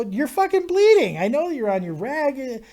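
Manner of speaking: high startled yelp of disbelief; alarmed. Retold or imitated. Teen boy yelping at girlfriend about the blood. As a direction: squeal it out, shocked at the blood.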